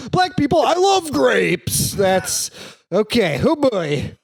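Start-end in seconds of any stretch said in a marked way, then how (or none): 0.1-1.9 mock poet voice. Mock poem voice.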